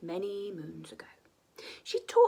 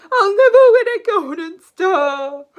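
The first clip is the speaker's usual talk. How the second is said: dragon despairing; 9 semitones higher, 19 dB louder than usual. Acted. Zog failing to capture a princess, wailing loudly. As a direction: wail it in mock despair. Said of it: "he cried".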